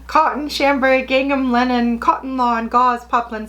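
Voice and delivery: high, slightly loud list reading. Pretend. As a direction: call out each fabric in a high, steady voice. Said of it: "Am I yelling?".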